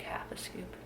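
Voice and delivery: barely voiced whisper. Real, not acted. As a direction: breathe it out softly. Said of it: "I have to whisper".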